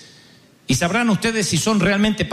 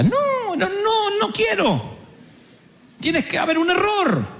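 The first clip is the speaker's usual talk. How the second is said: panicked refusal; 11 semitones higher, slower than usual. Retold or imitated. Moisés se resiste.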